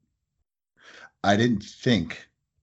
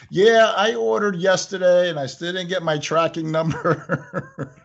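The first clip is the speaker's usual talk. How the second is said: higher mock customer voice, laughing; complaining customer; 7 semitones higher than usual. Owner mimics a caller complaining about tracking.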